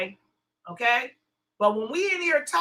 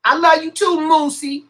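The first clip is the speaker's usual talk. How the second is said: loud, higher-pitched shout-out; calling out affection; 4 semitones higher, 9 dB louder than usual. Real, not acted. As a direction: call it out to a chat friend. Calling out to a chatter.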